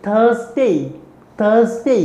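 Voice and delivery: teacher drilling थर्सडे; model pronunciation. Shown as a demonstration. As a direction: say थर्सडे with z, not s. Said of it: "बोलो".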